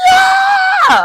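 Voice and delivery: very high, loud drawn-out cheer; mock cheering shout. Recapper mimicking Paul celebrating a caught traitor, mock cheering shout. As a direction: squeal it out in exaggerated triumph.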